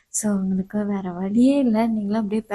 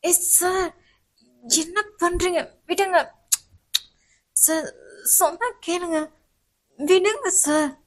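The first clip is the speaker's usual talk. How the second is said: higher, softer alarmed pleading; alarmed student; 5 semitones higher, 3 dB quieter, slower than usual. Acted. Student begging the professor to stop kissing her. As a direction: push him away, begging.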